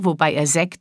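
plain reading voice

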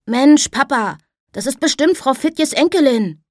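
put-on a lively boy cutting in on his father; bright boy's voice. Boy telling his father who the girl is, high and bright. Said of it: "sagte eine helle Stimme".

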